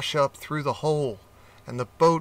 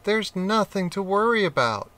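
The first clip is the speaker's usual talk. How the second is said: lightly voiced rat, higher; calm the nervous cat; reassuring rat; 6 semitones higher than usual. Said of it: "said the rat".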